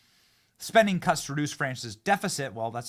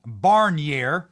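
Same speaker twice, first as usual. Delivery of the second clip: Iowa accent; 3 semitones higher, 10 dB louder than usual. Shown as a demonstration. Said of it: "I'm gonna say it in the, uh, with an Iowa accent".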